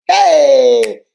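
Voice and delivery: sudden loud cry of revelation. Real, not acted. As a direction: burst out with a loud "Hey!". Spirit of God hits her.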